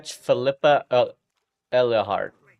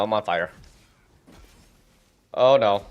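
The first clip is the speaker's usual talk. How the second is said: usual speaking voice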